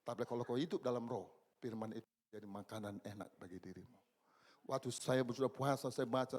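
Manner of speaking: usual speaking voice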